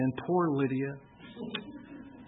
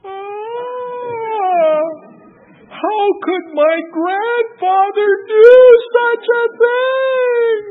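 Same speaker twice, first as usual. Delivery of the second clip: put-on very high, slow little girl's wail; granddaughter's voice; 14 dB louder, much slower than usual. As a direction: voice a shocked little girl, high and stretched. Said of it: "she's like".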